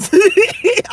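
high-pitched laugh breaking out; high cackling laugh. Real, not acted. Laughs at own bit.

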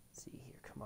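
whisper. Real, not acted. Choosing character, to himself.

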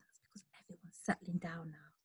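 fast hushed whisper; hushed bedtime whisper. Shown as a demonstration. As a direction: whisper so no one wakes. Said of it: "but much quieter than before".

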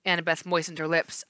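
usual speaking voice

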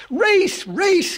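loud, high-pitched chant-like call; excited cry. A narrator's reading. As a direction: cry out like an eager hare. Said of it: "cried the hare".

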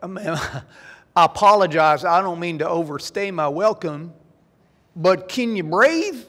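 Kentucky drawl taking its sweet time; slow, courteous Kentucky drawl, high and drawn out. Acted. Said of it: "the rhythms are kinda slow".

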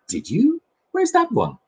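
put-on the boy in the book asks the mole; boy's voice. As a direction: read it as the curious little boy. The boy asks about another cake.

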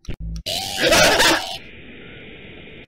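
very high scream of fright; startled scream. Real, not acted. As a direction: shriek as if jumped. Jump scare.

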